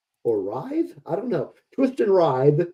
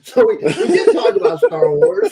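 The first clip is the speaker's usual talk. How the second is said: laughing; 9 semitones higher, 3 dB louder than usual. Real, not acted. Claiming they covered Star Wars.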